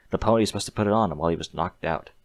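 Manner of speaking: usual speaking voice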